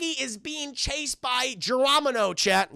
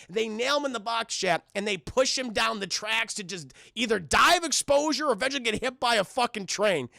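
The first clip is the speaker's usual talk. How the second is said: normal talking voice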